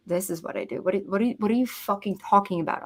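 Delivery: softly muttered, puzzled echo; baffled echo. Retold or imitated. Woman puzzled by her friend's idea, soft baffled retort. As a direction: repeat it back quietly, not getting it.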